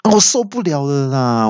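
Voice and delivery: high panicked cry; yelling in panic. Retold or imitated. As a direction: shriek at the GPS in alarm. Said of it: "就大叫说".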